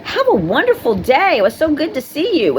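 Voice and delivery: bright, high, bubbly goodbye; cheery greeting. Retold or imitated. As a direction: gush happily like seeing an old friend.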